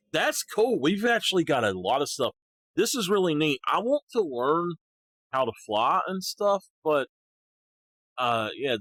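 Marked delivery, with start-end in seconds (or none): 0.1-6.7 excited. Real, not acted. Discovers new game features.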